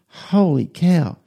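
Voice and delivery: a son spotting friends behind him; surprised exclamation. Retold or imitated. Son spots friends on escalator.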